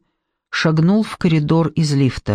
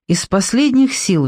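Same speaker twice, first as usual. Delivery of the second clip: plain reading voice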